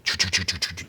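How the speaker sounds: rapid choo-choo jackhammer noise; imitated jackhammer. Storyteller imitating the jackhammer up above, staccato noise. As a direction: make a staccato drilling sound with the mouth. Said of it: "The next thing I hear is a jackhammer".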